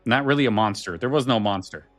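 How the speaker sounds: usual speaking voice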